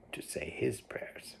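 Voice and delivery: whispered softly; hushed storybook whisper. A narrator's reading. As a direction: drop to a whisper. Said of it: "unvoiced whisper".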